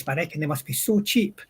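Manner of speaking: ordinary delivery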